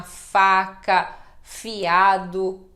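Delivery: faca and fiado said loud with a clear F; F sound demo. Shown as a demonstration. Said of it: "como em Fátima". (som de F, bem claro)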